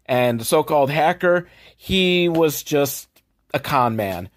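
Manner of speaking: usual speaking voice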